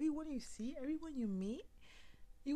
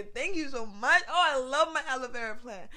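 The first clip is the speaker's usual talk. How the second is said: loving plant; 13 dB louder than usual. Pretend. Speaker thanks her real aloe vera plant, loud gushing. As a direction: pour love on the plant. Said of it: "I have to just say".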